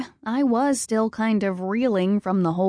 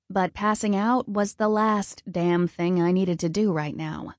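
ordinary delivery

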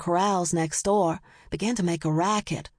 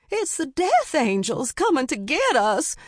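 high-pitched panicked cry; shrill-pitched doomsday holler; frightened holler; 8 semitones higher than usual. A narrator's reading. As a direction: holler it to the whole street in alarm. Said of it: "she hollered".

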